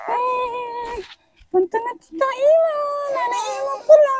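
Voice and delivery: very high, soft squeaky cooing; high baby-talk voice. Pretend. Talking to the nephew left behind, baby talk. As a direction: coo it way up high, teasing.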